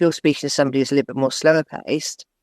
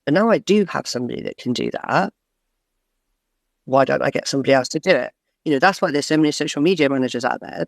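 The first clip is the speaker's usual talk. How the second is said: usual speaking voice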